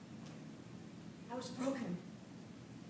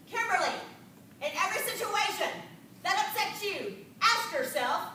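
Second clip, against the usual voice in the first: drill sergeant; 3 semitones higher, 6 dB louder than usual. Retold or imitated. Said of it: "she spoke like a drill sergeant".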